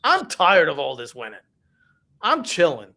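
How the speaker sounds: a sarcastic send-up of a bored winner; mock bored superstar. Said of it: "is he going".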